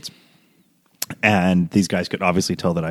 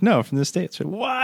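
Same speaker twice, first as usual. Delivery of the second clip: much higher, softer shocked voice; mock surprise; 9 semitones higher, 6 dB quieter, slower than usual. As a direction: play her stunned disbelief. Retold reveal and her shock.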